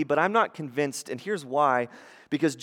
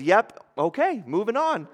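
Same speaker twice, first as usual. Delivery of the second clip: breezy brush-off; 7 semitones higher than usual. Acted. Peter's easy brush-off answer acted.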